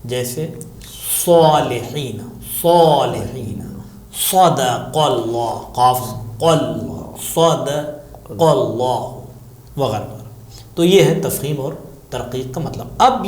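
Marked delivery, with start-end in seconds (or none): 1.3-3.6 صالحین with a thick, rounded "so" at the start; heavy letter sad. Shown as a demonstration. Teacher giving an example of sad, thick heavy صالحین. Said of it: "صاد کو موٹا ادا کیا جاتا ہے". 4.2-9.8 صدق اللہ drawn out slowly with thick sad and qaf; heavy letters. Shown as a demonstration. Teacher demonstrating thick letters, slow heavy صدق اللہ. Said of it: "صاد کو موٹا ادا کیا جاتا ہے".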